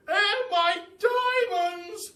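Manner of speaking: shrill sung wail, very high and loud, stretched out; a posh duchess shrieking for her diamonds; screaming Duchess. A narrator's reading. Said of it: "screamed the Duchess".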